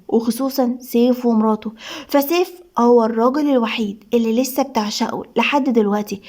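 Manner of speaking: ordinary delivery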